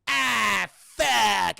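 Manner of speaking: loud, drawn-out cry of dismay; hammed-up shout of alarm; mock startled yell. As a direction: imitate getting caught, yelling it. Cartman turns and sees her.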